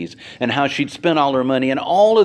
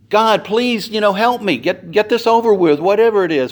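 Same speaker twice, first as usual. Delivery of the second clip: high, louder pleading prayer; impatient prayer; 10 semitones higher, 6 dB louder than usual. Acted. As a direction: hurry God along, exasperated. Jairus praying impatiently.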